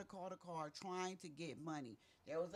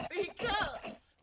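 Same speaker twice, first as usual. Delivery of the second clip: high laughter breaking through words; can't stop laughing; laughing hard; 12 semitones higher, 10 dB louder than usual. Real, not acted. Bursts out laughing mid-topic.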